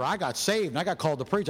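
ordinary talk, nothing marked

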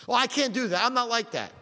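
high whiny protest, mimicking a reluctant listener; mock excuse.

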